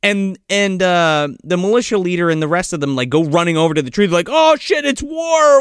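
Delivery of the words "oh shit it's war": high-pitched retold cry of panic; mock alarm. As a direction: call out in half-serious alarm.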